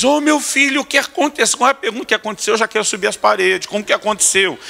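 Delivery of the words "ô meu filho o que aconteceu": much higher, cooing mother voice; doting mother. Retold or imitated. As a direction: coo over the hurt boy like his mom. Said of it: "Minha mulher corre pro menino e diz". (voz de mãe aguda e carinhosa)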